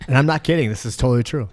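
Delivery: ordinary talk, nothing marked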